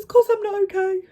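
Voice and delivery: high, hammed-up protest; retold distressed retort; mock upset. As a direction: squeak it as if near tears. Tearful retort to dad retold.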